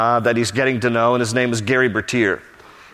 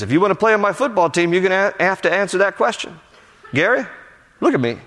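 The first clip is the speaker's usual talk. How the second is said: higher-pitched acted coach voice; demanding coach; 7 semitones higher than usual. Coach to silent player, acted demanding voice. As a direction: press the player for an answer.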